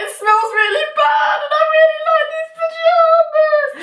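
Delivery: very high, slow wail; mock crying lament. Lamenting ruined pajamas to lover, high exaggerated tearful wail. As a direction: bawl it in comic misery.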